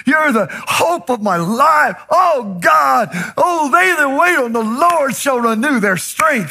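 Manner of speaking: shouted high, ecstatic praise; joyful praise. Retold or imitated. Preacher acting a believer shouting praise. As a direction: cry out to God with joy. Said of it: "you say".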